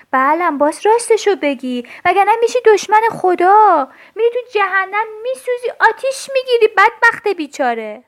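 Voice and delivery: loud, rising scolding threat. Acted. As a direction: hammer the doll with the threat of hell. Child scolds doll, threatens hell.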